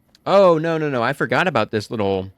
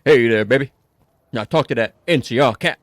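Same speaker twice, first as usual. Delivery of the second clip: put-on slipping, amateur [name] accent; louder, swaggering stab at an [name] accent; 5 dB louder than usual. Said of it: "losing the, uh, the king accent, the [name] accent".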